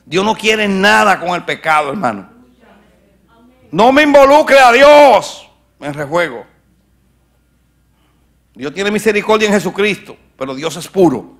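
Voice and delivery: loud, high fiery sermon voice; full-force preaching. Acted. Preacher booming at his congregation, fiery.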